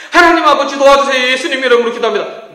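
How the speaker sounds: loud, high, rushed prayer; urgent emergency prayer. Shown as a demonstration. Pastor demoing a panicked prayer, loud and high. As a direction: cry out to God in a panic. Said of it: "급할 때 기도하는 연습".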